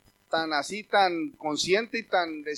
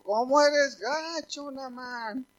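hurt complaint; 4 semitones higher, much slower than usual. Retold or imitated. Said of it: "se oyó que dijo".

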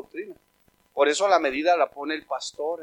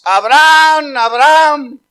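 loud, high drawn-out call from God; God shouting; 7 semitones higher, 9 dB louder, much slower than usual. Acted. God shouts to Abraham to stay his knife, loud calling shout. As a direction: call out the name urgently, stretched and loud. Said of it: "Y que le gritó Dios".

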